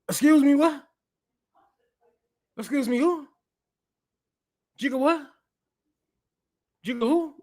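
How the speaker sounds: hearing the quote, faking shock; mock disbelief. Real, not acted. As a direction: play it like you can't believe your ears.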